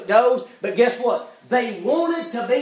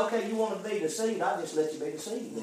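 much softer, offhand quote; quiet resigned concession; 10 dB quieter, faster than usual. Acted. God yielding to a sinner who wants deceiving, quietly resigned. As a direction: drop the volume and give in, letting them have it.

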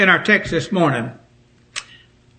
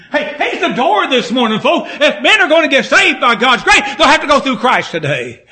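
raised, urgent sermon voice; forceful preaching; 5 semitones higher than usual. Real, not acted. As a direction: drive the message home to the congregation.

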